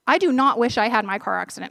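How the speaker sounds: normal talking voice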